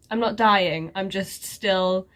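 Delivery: usual speaking voice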